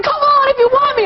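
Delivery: very high, hammed-up challenge; mock movie taunt. Host sends up the guest's movie scene, high mock taunt. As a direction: call it out high, sending up the actress. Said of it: "She's like".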